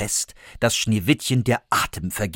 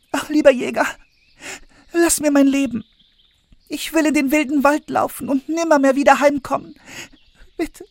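put-on much higher, quick tearful girl's plea; pleading girl; 3 dB louder, faster than usual. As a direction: beg for your life like a frightened girl. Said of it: "begann Schneewittchen zu weinen und sprach".

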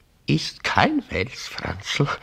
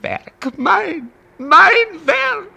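much higher, louder wail of guilt; anguished cry; 8 dB louder, much slower than usual. Acted. Guilt-stricken father to his son, anguished cry. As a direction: cry out in despair.

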